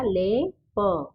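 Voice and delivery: ले and प said slowly apart; slow split reading; syllable by syllable. Shown as a demonstration. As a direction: split लेप into ले and प. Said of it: "तो क्या बन गया".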